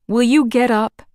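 anxious. A narrator's reading. Small boy nervously asking the giant lumberjack to rise. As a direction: ask nervously, voice lifting. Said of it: "Marvin asked anxiously".

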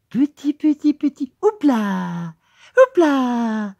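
very high coaxing sing-song; cooing teacher. Acted. Teacher urging the boy on, very high sing-song. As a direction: coax him along like a toddler.

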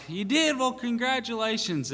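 high-pitched, delighted disbelief; excited congratulations. Retold or imitated. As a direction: gush over his win, voice jumping up. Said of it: "I was all excited for him".

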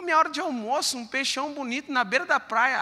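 ordinary talk

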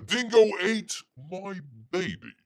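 cartoonish Aussie cliché line; Australian accent. Host joking to viewers about Shad, in a mock Australian accent. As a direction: toss off a stock Australian quote. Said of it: "you gotta talk like this".

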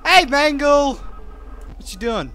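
normal talking voice

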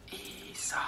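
weak, hoarse and quiet; a worn-out father's hoarse whisper. A narrator's reading. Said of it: "isä sanoo käheästi".

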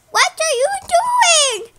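high-pitched panicked question; acted alarmed protest. Blue facing the gun, acted alarm. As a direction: cry out high in mock alarm. Threatened penguin protests in story.

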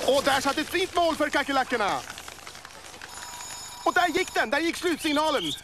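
high, rising commentator call; excited goal call. Acted. Commentator calls goal, final whistle. (upphetsad målrop)

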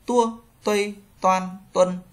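"Tua, tuây" with unaspirated Vietnamese t; D read as t. Shown as a demonstration. Teacher modelling the letter for learners, "Tua" with plain t. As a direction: drill "toan, tuân" evenly, no puff on the t. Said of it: "các bạn đọc thành chữ tờ".

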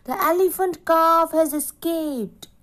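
high-pitched alarm call at normal volume; storyteller's mild shout, pitched well up; narrated shout. A narrator's reading. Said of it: "shouting loudly".

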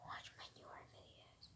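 soft spooky whisper; a hushed, eerie ask to the viewers. Pretend. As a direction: breathe the plea like a secret. Creepy plea at the outro.

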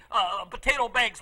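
ordinary talk, nothing marked

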